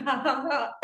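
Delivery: giggling into ha ha; high giggle. Real, not acted. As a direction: laugh for real. Laughter yoga session.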